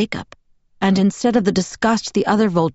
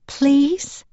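put-on small child voice; 7 semitones higher than usual. A narrator's reading. Child Renesmee asks.

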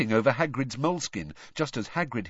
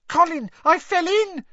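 put-on very high, excited small boy; shrill; 7 dB louder than usual. A narrator's reading. Boy telling his brother, shrill and excited. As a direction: pipe up shrilly and loud. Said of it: "he said shrilly".

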